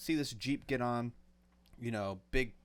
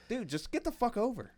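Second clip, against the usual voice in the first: higher, fed-up plea; exasperated; 12 semitones higher than usual. Real, not acted. Fed up with drivers who won't move over, exasperated. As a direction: vent like you're stuck behind them. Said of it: "venting at road ragers".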